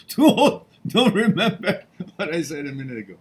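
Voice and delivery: laughing at himself; high, loud laugh through the words. Real, not acted. Joking about getting old.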